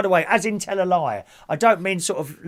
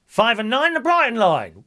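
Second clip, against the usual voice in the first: bingo caller; 4 semitones higher, 5 dB louder than usual. Acted. Calls bingo numbers.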